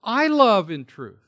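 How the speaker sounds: I pitched high and loud; emphatic I. Shown as a demonstration. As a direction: punch I at the start. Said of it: "He's being emphatic".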